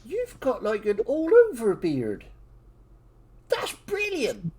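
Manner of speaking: put-on excited dwarf child. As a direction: gush like an amazed little kid. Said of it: "And the dwarven child goes".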